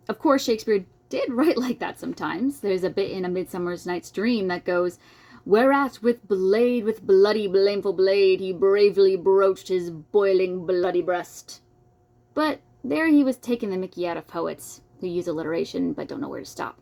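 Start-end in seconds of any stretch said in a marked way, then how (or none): 5.4-11.6 loud, slow, overblown verse; hammed-up bombast. Mocking overdone alliteration in a loud, hammy recital. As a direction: ham up the bad poet's lines.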